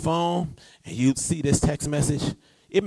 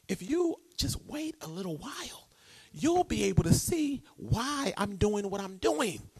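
gentle reassuring God; 2 semitones higher, 7 dB quieter than usual. Acted.

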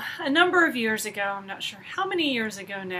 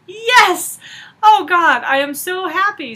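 much higher, much louder burst of joy; joyful outburst; 8 semitones higher, 10 dB louder than usual. Retold or imitated. Answering God's rebuke with a thrilled, loud shout of joy. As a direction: shout your thrilled yes to God. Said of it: "I was like".

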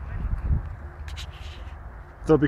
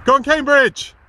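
high, loud call of support; cheering the Cambridge runners; cheering shout; 13 semitones higher, 9 dB louder than usual. Real, not acted. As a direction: shout it after the passing runners.

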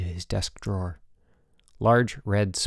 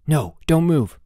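loud, higher-pitched warning; urgent cry; 5 semitones higher, 6 dB louder than usual. A narrator's reading. Boots stopping his sick roommate moving, crying out. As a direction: cry out to stop him. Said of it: "Boots cried".

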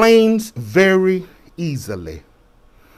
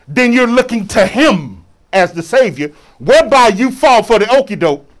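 forceful, raised sermon delivery; preaching; 7 semitones higher, 6 dB louder than usual. Real, not acted. Preacher warning church against false saviors, preaching. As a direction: preach it hard to the congregation.

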